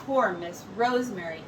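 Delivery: ordinary delivery, nothing marked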